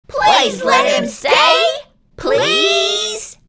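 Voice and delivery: very high, loud, drawn-out pleading; begging children. A narrator's reading. Children chiming in to beg the nurse. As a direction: beg with all their hearts. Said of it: "the other children chimed in".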